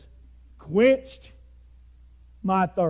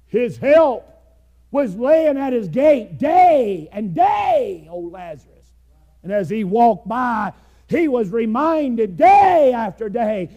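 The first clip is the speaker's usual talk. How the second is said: loud, raised preaching voice; fiery preaching; 5 semitones higher, 7 dB louder than usual. Acted. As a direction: press the point hard on the congregation. Preaching at full force.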